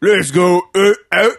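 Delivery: put-on hammy British accent. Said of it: "British accent".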